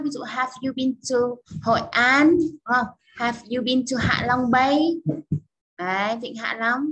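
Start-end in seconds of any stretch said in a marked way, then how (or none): 3.2-5.0 slow with a lift on Bay; slow, clear model question. Shown as a demonstration. Teacher models a travel question slowly and clearly. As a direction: read it slowly so the class can follow. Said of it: "mình có thể đưa vào những cái thành phố khác".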